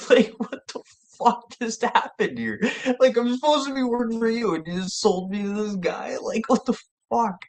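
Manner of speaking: high-pitched, half-laughing mock outrage; incredulous protest. Retelling his shock at being sold.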